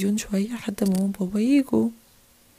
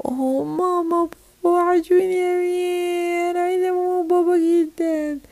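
high, drawn-out mock wail; crying little girl; 6 semitones higher than usual. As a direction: wail for mum and dad like a tot. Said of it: "هي بتعيط ليه دي؟". (عياط طفلة بصوت عالي الطبقة)

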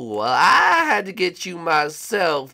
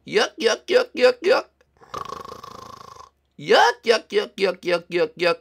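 mocking chuckle; 2 semitones higher than usual. Villain's signature chuckle.

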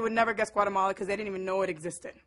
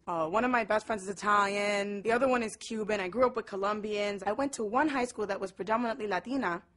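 normal talking voice

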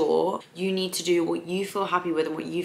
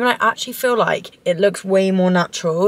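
ordinary talk

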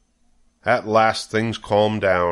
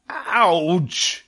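high, drawn-out yelp; scream of pain; 8 semitones higher than usual. A narrator's reading. Wilbur gets his tail bitten, a high acted scream. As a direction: yelp as if bitten, without much volume. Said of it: "he screamed".

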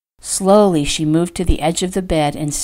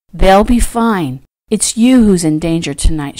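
ordinary delivery, nothing marked

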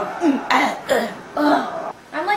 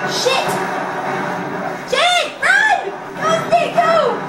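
very high, loud frantic cheers; a hint of laughter in the cheering; excited yelling; 3 dB louder, much slower than usual. Real, not acted. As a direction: shout at the screen to make him run. Frantic gameplay, cheering Snake.

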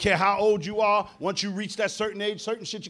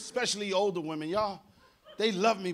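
ordinary delivery

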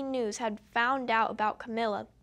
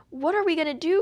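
higher and louder, panicked plea; desperate cry; 5 semitones higher, 6 dB louder, faster than usual. A narrator's reading. Mother despairing over her changing daughter, crying out. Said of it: "cried Miss Cream".

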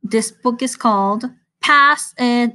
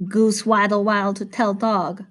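usual speaking voice

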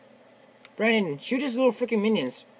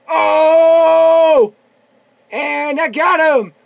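long, high, loud victory yell; triumphant shouting; 10 semitones higher, 14 dB louder, much slower than usual. Real, not acted. Gamer whose train just hit the enemy, triumphant shouting.

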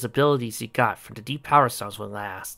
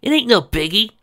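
high breezy brush-off; cocky; 11 semitones higher, 7 dB louder than usual. Acted. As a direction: shrug it off loudly. Sonic shrugging it off.

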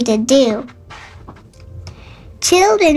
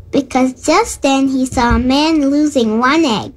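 usual speaking voice

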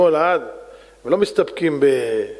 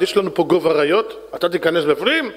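ordinary delivery

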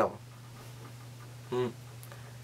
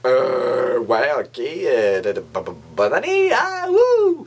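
awkward forced cheer. Retold or imitated. [name] answers the drunk date, stammering then shrieking forced cheer. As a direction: force enthusiasm after stumbling. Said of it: "Moi, je suis comme".

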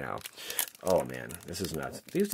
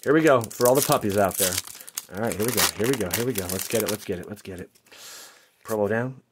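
ordinary talk, nothing marked

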